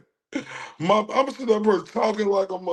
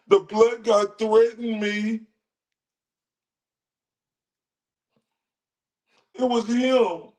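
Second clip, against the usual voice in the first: higher mock accuser voice; mock Karen; 3 semitones higher than usual. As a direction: point the finger like a Karen.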